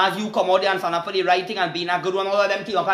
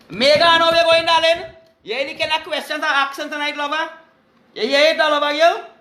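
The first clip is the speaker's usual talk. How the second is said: high, raised hailing voice; high, drawn-up call across the airwaves; calling out greetings; 7 semitones higher, 4 dB louder than usual. Real, not acted. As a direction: holler a welcome to a favourite listener. Host hails Megan on the show.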